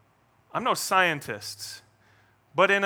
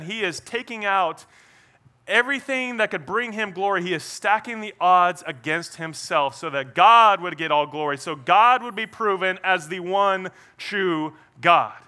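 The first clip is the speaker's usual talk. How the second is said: ordinary delivery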